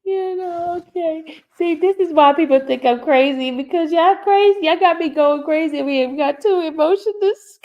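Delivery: high voice, caught between crying and laughing; laughing through tears. Real, not acted. Streamer telling viewers they drive her crazy, laughing through tears. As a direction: talk high while recovering from a laughing fit. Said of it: "We got different emotion going on".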